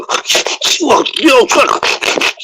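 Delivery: snorty warthog grunts; snorting warthog. Shown as a demonstration. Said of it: "I can make the sounds he makes in between".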